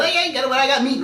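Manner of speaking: high, coaxing call; teasing zookeeper. Pretend. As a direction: lure them in with a high teasing voice. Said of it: "he goes".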